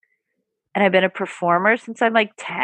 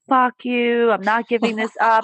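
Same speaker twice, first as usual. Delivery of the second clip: defiant inner critic, pitched up, mildly voiced; mock self-hating voice; 4 semitones higher than usual. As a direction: lightly play a sneering gremlin who won't let go. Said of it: "the self-hating voice is like".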